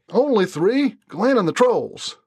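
lightly drawled, defensive Brent; Brent, evasive Southern drawl. A narrator's reading. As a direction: shrug it off like a cornered Southern man. Brent counters.

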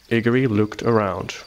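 ordinary delivery, nothing marked

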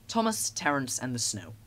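ordinary delivery, nothing marked

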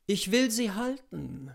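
ordinary delivery

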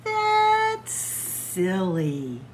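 very slow, drawn-out scoff; slow dismissal. Acted. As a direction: stretch it out, unimpressed.

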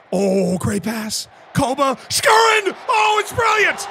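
high, loud yelled commentary; celebrating a late goal; ecstatic shouting. Real, not acted. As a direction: scream the whole goal play out.

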